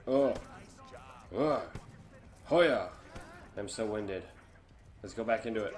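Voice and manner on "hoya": kick effort yell. Real, not acted. Throwing a kick.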